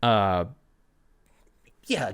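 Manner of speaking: ordinary talk, nothing marked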